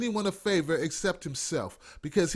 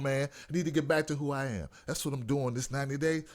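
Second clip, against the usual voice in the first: ordinary delivery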